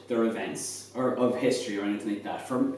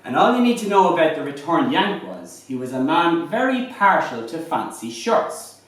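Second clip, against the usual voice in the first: higher, lively Irish fireside storytelling; lilting irish storyteller; 6 semitones higher, 4 dB louder than usual. Acted. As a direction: spin a comic tale by the fire, building to the punchline. Shanachie performs a comic goat tale.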